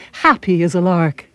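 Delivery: usual speaking voice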